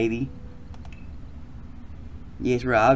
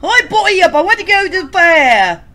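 squeaky high voice; 12 dB louder than usual. Acted. Voicing character, high squeaky.

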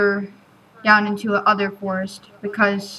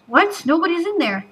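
surprised; 7 semitones higher than usual. Real, not acted. Surprised to find shed empty.

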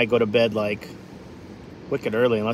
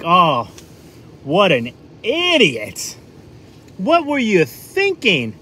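slow, much higher mock-exasperated jeer; mock scolding; 6 semitones higher, 5 dB louder, much slower than usual. New Englander razzing a stuck driver, mock scolding. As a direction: tease them like a fool, drawn out. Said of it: "what we'll do is be like".

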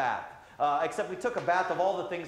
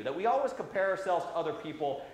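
usual speaking voice

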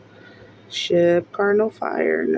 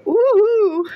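high celebratory "woohoo"; real, delighted cheer; excited whoop; 13 semitones higher, 4 dB louder than usual. Real, not acted. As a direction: let out a high whoop of joy. Celebrates a winning ticket.

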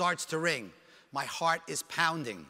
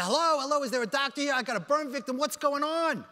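higher-pitched, urgent call down a hospital hall; retold yelling for help; 6 semitones higher than usual. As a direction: re-enact calling out for a doctor. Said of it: "What's all the yelling?".

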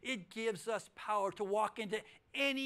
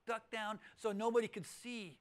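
usual speaking voice

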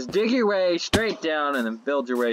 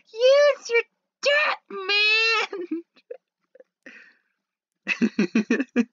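squeaky, much higher yelled catchphrase, slowed; silly joke shout; 3 dB louder, slower than usual. Pretend. Gamer repeating his inside joke, squeaky mock shout. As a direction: belt the goofy line out, slow and loud.

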